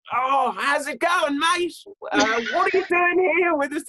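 high-pitched, exaggerated Australian; Australian accent. Penguin greeting someone in a restaurant, jokey Australian accent. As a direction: put on a high Aussie greeting voice. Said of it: "I don't know why I'm Australian".

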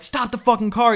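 high mock yell at the driver; retold scream. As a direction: yell it like you're clinging to a moving car. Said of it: "And he's screaming".

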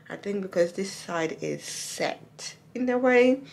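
lip stretched aside by finger; talking while showing teeth; finger pulling lip. Real, not acted. Seen 0: Finger hooks lip corner aside to show teeth while speaking.

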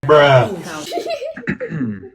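big loud laugh; loud burst of laughter. Real, not acted. Reacting to a cold joke.

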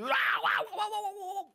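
panicked yelps. Acted. Struck again by poison.

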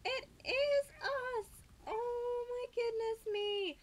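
high, loud and slow with joy; thrilled, slow squeal; overjoyed. Real, not acted. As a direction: savour the delight, drawn out. Overjoyed kitten discovery.

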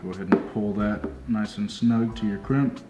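usual speaking voice

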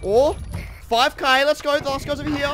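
loud, high hyped-up callout; excited rallying call. Real, not acted. Player chasing a five K, excited rallying call. Rallying team toward last opponent.

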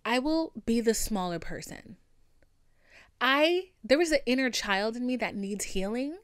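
loud, indignant rant; fired-up rant. Real, not acted. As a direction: vent the anger loudly. Heated rant at instigator.

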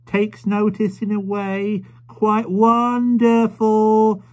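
high, slow and loud, word by word; a proud young mother shouting into an old man's ear; high, slow, proud mother's shriek; shrieking to a deaf man. A narrator's reading. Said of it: "shrieking these short sentences into the old man's ear".

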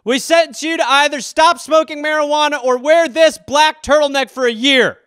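loud, high mock judge; sentencing judge. Court passes sentence on a weed smoker, mock-solemn and loud. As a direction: proclaim it like a court handing down sentence. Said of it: "is that court ordered?".

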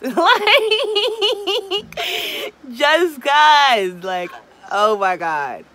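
high, loud laughing just 'cause; giddy laugh. Real, not acted. Giddy before sending launch.

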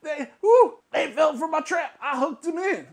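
high, loud smug gloating; mocking gloat. Vlogger mimicking Tommy bragging he trapped them. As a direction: ham up a gloating fake laugh. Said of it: "come out later and go".

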